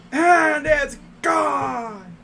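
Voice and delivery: slow, high, shouted homer call; home run call. Shown as a demonstration. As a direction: stretch the call out big. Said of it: "perfect my home run call".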